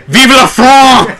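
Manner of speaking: loud, slow French rallying shout; rally the crowd in hammy French; French revolutionary cry. Pretend. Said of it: "That's what they shouted at the French Revolution".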